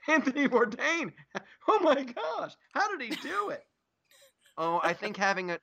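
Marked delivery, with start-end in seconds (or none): 0.1-3.7 high, squealing mock wonder; mock amazement. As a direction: gasp in fake awe at it. Mock amazement at Bourdain article.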